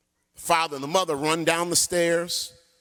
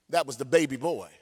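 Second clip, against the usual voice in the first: ordinary talk, nothing marked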